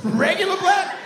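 squeaky, incredulous question; loud, high, mock-astonished; high-pitched disbelief. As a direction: shoot the voice up on the repeat.

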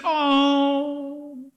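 mock adoring coo; imitated cooing. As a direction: coo like an audience over a baby animal. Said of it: "just like you said".

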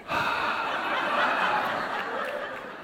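long, breathy sigh; exasperated sigh. Acted. Passenger stuck waiting in line, long exasperated sigh. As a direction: let out a long weary breath.

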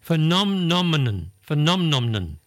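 phenomenon with extra nom syllables; tangled pronunciation. Shown as a demonstration. Host demonstrating a tricky word, Phenomenon with doubled syllables. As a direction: stretch Phenomenon out slowly, fe-NOM-nom-enon. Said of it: "People say".

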